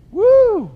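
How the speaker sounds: high, loud incredulous whoop; a sharp cry of mock amazement; preacher whoops at the congregation. Real, not acted. Preacher whoops at Jonah's priorities.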